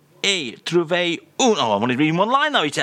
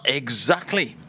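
usual speaking voice

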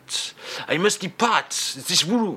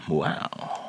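usual speaking voice

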